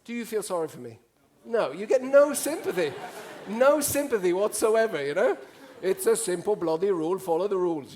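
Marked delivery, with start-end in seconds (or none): 1.4-7.9 mock Norwegian rebuking him, loud and stern; mock-stern scolding. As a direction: play the stern rule-keeper.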